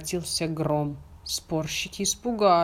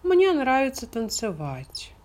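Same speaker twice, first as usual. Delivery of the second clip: duckling voice; 7 semitones higher than usual. Acted. Duckling character continues.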